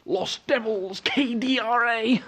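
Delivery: goofy shouted reading. Pretend. Reads graffiti in a loud sing-song voice.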